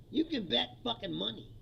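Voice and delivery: usual speaking voice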